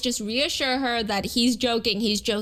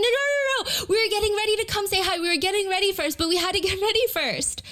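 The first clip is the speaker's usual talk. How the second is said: high-pitched, hurried flustered protest; playful panicked objection, high voice; mock freak-out; 9 semitones higher, faster than usual. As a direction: imitate girls freaking out, pitched way up. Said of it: "they start freaking out".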